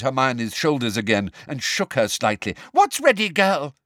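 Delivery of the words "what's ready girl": high, loud demand; teacher demanding an answer. Acted. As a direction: insist on an answer. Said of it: "demanded Umbridge ... shook her".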